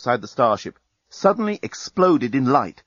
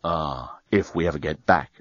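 Dan's voice; 7 semitones lower, 4 dB quieter than usual. A narrator's reading. Dan echoing Lucy's hope, lower and quieter. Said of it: "agreed Dan".

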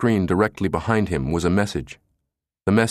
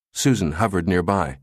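usual speaking voice

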